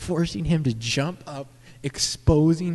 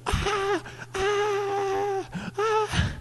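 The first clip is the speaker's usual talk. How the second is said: very high, shrill mock screams; screaming in shock; much slower than usual. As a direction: shriek like you just saw a shooting. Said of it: "people were like".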